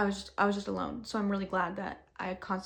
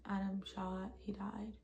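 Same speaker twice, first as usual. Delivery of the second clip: much softer, toneless and emotionless; flat numb voice; 11 dB quieter, slower than usual. Retold or imitated. Telling callers what happened, numb and much softer. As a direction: say it like shock has drained everything. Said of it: "Like, no emotion".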